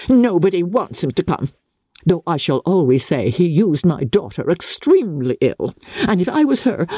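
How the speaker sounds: lightly voiced peevish mother, pitch climbing; a hint of a huffy matron nursing a grudge; spiteful Mrs Bennet. Mother complaining to Lizzie about Bingley, mildly peevish.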